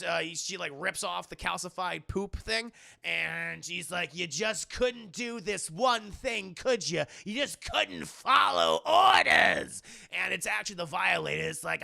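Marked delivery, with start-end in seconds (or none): 4.1-9.8 slow, drawn-out rebuke building to a shout; acted villain scolding. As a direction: scold slowly, then yell the last words.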